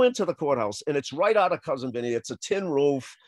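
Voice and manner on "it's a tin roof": usual speaking voice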